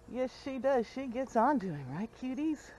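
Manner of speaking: cooing it to the babies; pet talk. Real, not acted. Agreeing with the goat kids in a soft high coo. Repeated to goats, higher.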